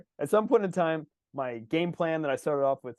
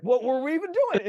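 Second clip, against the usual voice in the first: high-pitched mock exasperation; bewildered; 12 semitones higher, 3 dB louder than usual. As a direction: ask it in comic, voice-raised confusion. Team answers the rider, confused.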